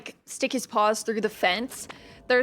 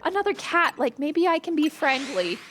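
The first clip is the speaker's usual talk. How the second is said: timid cat voice; 7 semitones higher than usual. Retold or imitated. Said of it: "And she was like".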